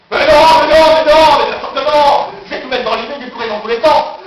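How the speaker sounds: very loud, high, repeated protest; exasperated high yelling; frantic rejection. Acted. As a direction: burst out shouting over your own thought. Violently rejects his own idea.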